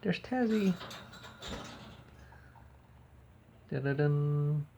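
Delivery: high cooing at the bird. Real, not acted. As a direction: gush over the bird.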